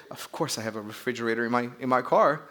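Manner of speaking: low, soft mock British accent; lightly put-on British for a Rolls Royce driver; badly faked British, starting low and quiet. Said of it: "trying to do a British accent".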